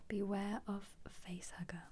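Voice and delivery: normal talking voice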